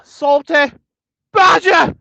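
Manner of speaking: very high, loud mock holler; hollered name. Streamer announcing a viewer in chat, high hammed-up holler. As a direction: shout each word out separately, high and playful.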